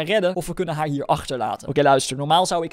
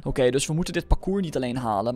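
normal talking voice

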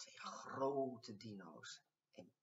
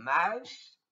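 loud rising call of Muis; laughing call; 5 semitones higher, 13 dB louder than usual. A narrator's reading. Dinos calling to the mouse, loud and rising. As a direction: call out fondly over laughter. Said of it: "moesten de dino's hard lachen en riepen".

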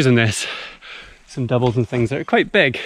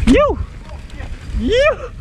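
high excited shout; excited yell. Real, not acted. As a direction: whoop off the drop. Yelling on a big drop.

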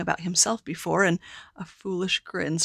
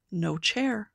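plain reading voice

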